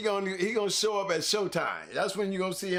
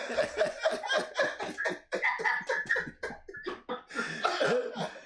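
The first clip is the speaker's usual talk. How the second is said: high, breathy wheeze of laughter; squealing silent laugh; 13 semitones higher than usual. Real, not acted. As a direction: laugh with no voice left. Cracking up at dog joke.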